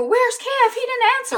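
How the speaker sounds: high-pitched acted worry; alarmed questioning. Jack asking Rebecca about missing son, alarmed. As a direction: imitate an anxious parent.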